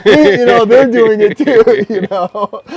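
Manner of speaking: loud, high, laughing through the words. Real, not acted. Cracks up at own story.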